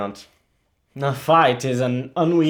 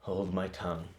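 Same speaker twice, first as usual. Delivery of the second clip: quiet resignation; 8 semitones lower, 15 dB quieter than usual. Acted. Hamlet falls silent.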